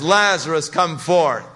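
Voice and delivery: a loud command to the tomb. Acted. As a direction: call the dead man out by name. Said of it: "In a loud voice, he says".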